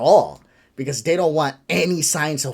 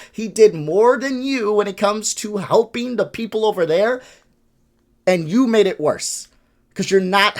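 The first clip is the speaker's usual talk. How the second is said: louder, higher, furious lecturing; heated rant; 6 semitones higher, 4 dB louder than usual. Real, not acted. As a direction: hammer it home, angrier each line. Heated rant at Bill Burr.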